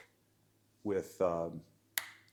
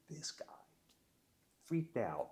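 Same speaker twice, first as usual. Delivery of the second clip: normal talking voice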